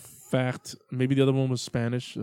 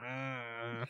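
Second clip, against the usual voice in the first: put-on Cuban accent; 15 dB quieter than usual. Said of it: "That's my Cuban accent".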